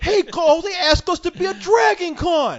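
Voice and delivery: high, loud, giddy put-on voice; mocking eager sucker. Mocking eager convention hopefuls to his cohost, high and giddy. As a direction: squeal it like an overexcited rube. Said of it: "you think I'm just gonna jump?".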